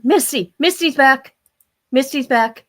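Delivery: loud, high happy welcome; excited welcome. Real, not acted. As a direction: cheer her return.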